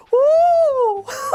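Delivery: loud, very high effeminate "Oh!"; a startled little shriek jolting awake; startled high yelp. Retold or imitated.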